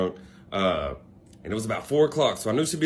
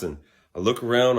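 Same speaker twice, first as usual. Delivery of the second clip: usual speaking voice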